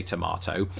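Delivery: tomato as "tuh-MAH-toh"; British tomato. Shown as a demonstration. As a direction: say "tomato" with an open AH. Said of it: "I do say tomato".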